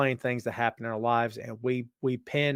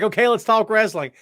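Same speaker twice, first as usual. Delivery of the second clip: mock eager; 8 semitones higher, 10 dB louder than usual. Quoting Tina eagerly.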